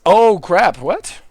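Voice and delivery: usual speaking voice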